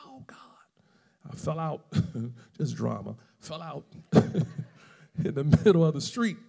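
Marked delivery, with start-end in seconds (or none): 0.1-0.4 high-pitched, hushed mock holler; small, high imitation of a wail; retold holler. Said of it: "I was hollering".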